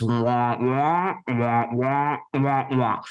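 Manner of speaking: muffled wah-wah of the Peanuts teacher; lightly voiced cartoon-adult babble. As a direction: mimic a muted trumpet droning on. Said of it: "The teacher from the Peanuts".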